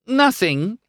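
high, airy brush-off; casually dismissive; feigned nonchalance. A narrator's reading. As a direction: play it as no big deal. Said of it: "trying to sound as if it was no big deal".

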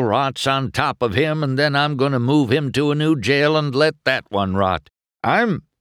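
high-pitched, building angry tirade; ranting. A narrator's reading. Judge out to jail whoever wrecked his town, ranting. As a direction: rail at the whole court, outraged. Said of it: "Doc ranted".

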